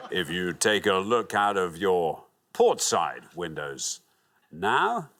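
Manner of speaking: slow, calm captain over the intercom; lightly voiced pilot, unhurried pauses; airline pilot announcement. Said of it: "I did my pilot voice".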